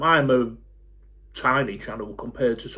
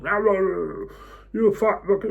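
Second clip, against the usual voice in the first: slow, high jeering voice of a keyboard troll; mock troll insult; 7 semitones higher, much slower than usual. Vlogger imitating a keyboard troll, high and slow. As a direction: sneer it out like an online troll. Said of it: "default straight on the keyboard".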